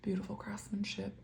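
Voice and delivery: soft admiring whisper, slowed down. Real, not acted. Admiring the abalone pen up close, whispered. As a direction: breathe it out as if admiring a treasured pen.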